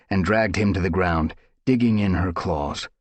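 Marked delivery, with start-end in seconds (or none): none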